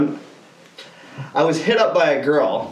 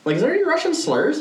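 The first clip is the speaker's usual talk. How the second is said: a hint of a naive ask; higher imitation of himself asking; mock curious question; 10 semitones higher than usual. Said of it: "I'm like".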